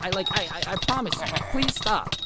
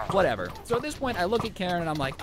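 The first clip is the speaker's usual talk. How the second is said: ordinary delivery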